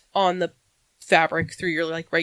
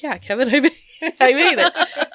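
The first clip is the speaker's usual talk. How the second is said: higher, laughing retold reply; amused retort; 6 semitones higher, 5 dB louder than usual. Maker confirming to her friend, laughingly emphatic.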